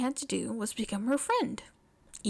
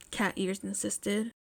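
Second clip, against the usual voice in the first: ordinary delivery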